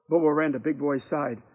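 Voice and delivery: plain reading voice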